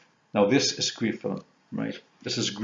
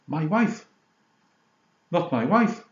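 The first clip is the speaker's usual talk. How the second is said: laundrette man; 6 semitones higher, slower than usual. Retold or imitated. Retelling the laundrette scene.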